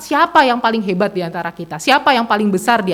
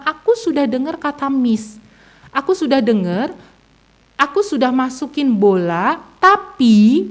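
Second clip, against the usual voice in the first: imitated small child, higher, in slow broken phrases; halting child's retelling; 5 semitones higher, slower than usual. Five-year-old explains to mother, halting child voice. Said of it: "dia menceritakan dengan terbata-bata".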